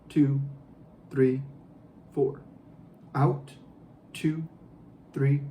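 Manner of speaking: slow, steady counting; guided breathing count. Shown as a demonstration. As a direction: count the breaths calmly, evenly. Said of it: "I'm gonna guide you through".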